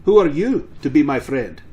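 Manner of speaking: loud, indignant farmer; brusque rebuff. Acted. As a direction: throw the words back at the stranger. Farmer rebuffs the stranger rudely.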